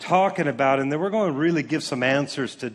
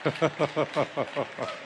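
chuckle; 3 semitones lower, 7 dB quieter than usual. Real, not acted. Congregation joke, laughter.

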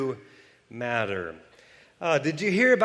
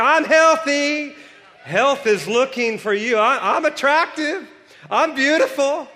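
much higher, loud preaching shout; shouted declarations; 11 semitones higher, 7 dB louder than usual. Acted. Pastor rousing the congregation, shouting declarations. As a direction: declare it over them, full force.

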